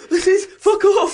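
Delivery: very high, loud laughing retort; laughing disbelief. Retold or imitated. Retelling his mates arriving, laughing high retort. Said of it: "I was like".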